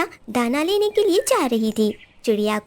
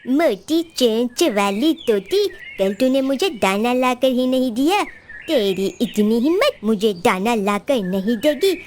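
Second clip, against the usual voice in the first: low, slow menacing crow voice; bully crow; 3 semitones lower than usual. Acted. Crow bullies the parrot before beating her, menacing low voice. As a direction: threaten her slowly from low down.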